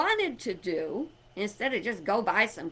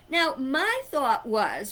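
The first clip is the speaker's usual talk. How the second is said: normal talking voice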